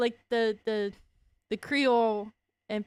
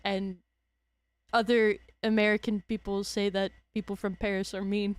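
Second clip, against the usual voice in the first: normal talking voice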